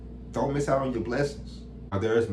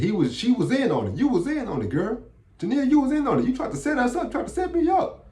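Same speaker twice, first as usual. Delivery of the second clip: high, rising accusation, climbing higher; accusing boss; 10 semitones higher, faster than usual. Retold or imitated. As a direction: point the finger, voice climbing. Boss accuses her of setup.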